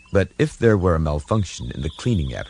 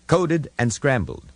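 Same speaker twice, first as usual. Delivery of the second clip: lightly voiced aide; 7 semitones higher than usual. Aide announcing the call.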